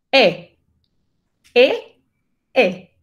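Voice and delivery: "E" repeated as an open vowel leaning toward A; E con algo de A. Shown as a demonstration. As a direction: drill the open French "E", each one separate. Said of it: "es una E que tiene un poco de A". ("E" abierta con un poco de A)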